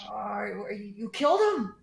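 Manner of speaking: high, timid goblin stammering in fear; frightened high goblin pleading. Acted. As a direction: squeak it out like a scared little goblin. Goblin asks about bugbears.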